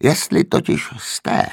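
slow, husky and peevish; grumpy old man. A narrator's reading. The old man grumbles at his father, husky. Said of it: "nevrle pokračoval stařec".

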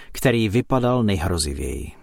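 plain reading voice

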